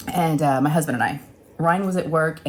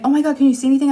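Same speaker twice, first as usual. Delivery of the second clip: higher, louder panicked cry; 8 semitones higher, 6 dB louder, much faster than usual. Retold or imitated. Recalling the chipped tooth to her husband, panicked. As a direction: sound alarmed at the crunch.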